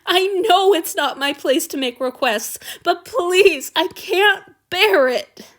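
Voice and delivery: high, pleading voice; desperate pleading. Acted. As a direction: beg desperately.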